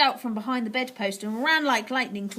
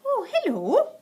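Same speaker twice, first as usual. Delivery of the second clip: put-on much higher, light old lady; grandmother's voice; 12 semitones higher, 4 dB louder, slower than usual. A narrator's reading. Grandmother calmly greeting the witch from below. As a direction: greet sweetly, unruffled.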